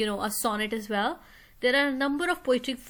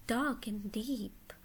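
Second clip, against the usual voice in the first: whisper; 6 dB quieter, much slower than usual. Shown as a demonstration. Teacher whispering the poem's line to students, slow hush.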